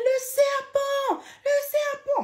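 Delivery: very high, slow, panicked cry; mother screaming. Retold or imitated. Mother screaming to her daughter about the snake. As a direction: shriek the warning again and again. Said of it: "Ma mère crie".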